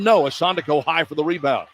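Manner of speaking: ordinary talk, nothing marked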